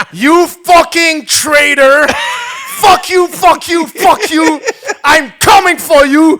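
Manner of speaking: high, loud, slow hammed-up fury; mock rage. Reading out the woman's tweet to Mark Carney, hammed-up slow furious yell. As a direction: spit out each word like an unhinged troll. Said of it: "Elle a tweeté à Mark Carney".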